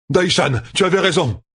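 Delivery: urgent, high-pitched shout; crying out an alarm. A narrator's reading. Messenger galloping back to camp warns of a monster horde, crying out. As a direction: call the alarm out high, without much extra volume. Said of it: "cria-t-il".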